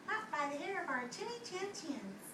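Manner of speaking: high squeaky little pig voice; small defiant pigs. Acted. Two little pigs refusing the wolf, high and small.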